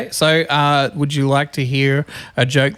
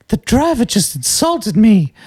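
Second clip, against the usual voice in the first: slow, higher-pitched indignation; mildly fuming woman; 6 semitones higher than usual. Acted. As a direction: act out an offended passenger, drawn out. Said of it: "sits down fuming".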